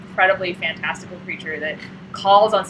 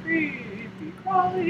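slow, drawn-out toy ad announcer; higher, slow mock ad voice; TV commercial voice; 7 semitones higher, 5 dB quieter, much slower than usual. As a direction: sell it like a spooky kids' commercial jingle. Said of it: "I remember the commercial".

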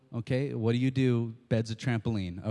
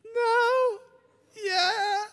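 very high whimpering no, then a sobbing yeah; crying kid. Retold or imitated. Little boy crying, admitting he jumped on the bed. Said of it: "And I was like".

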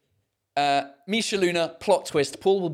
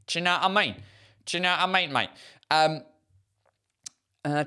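ordinary talk

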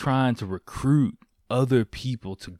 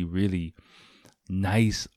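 usual speaking voice

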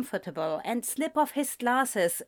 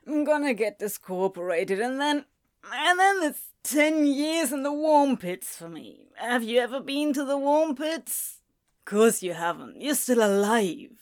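slurring, self-pitying demon voice; drunken demon; 4 semitones higher than usual. Acted.

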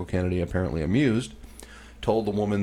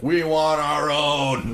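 state's demand; 7 semitones higher, 5 dB louder, much slower than usual. Retold or imitated. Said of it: "South Carolina's like".

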